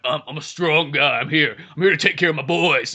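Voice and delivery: put-on strongman voice; big buff guy. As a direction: imitate a beefy protector of the boys. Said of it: "the big buff guy's voice".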